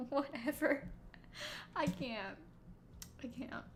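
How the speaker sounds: ordinary talk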